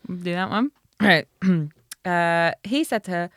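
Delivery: normal talking voice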